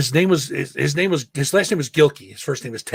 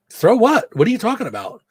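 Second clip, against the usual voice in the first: high, louder incredulous protest; indignant disbelief; 8 semitones higher, 3 dB louder than usual. Retold or imitated. Retelling the lunchroom monitor exchange, indignant disbelief. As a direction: Throw what, pitched up in shock.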